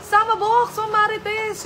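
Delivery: high, loud greeting to viewers; excited shout-out. Real, not acted. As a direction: call out their names with delight. (masiglang pagbati sa mga manonood)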